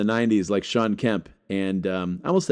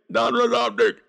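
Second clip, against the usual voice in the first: excited; 12 semitones higher than usual. Real, not acted. Repeats brand name, hyped.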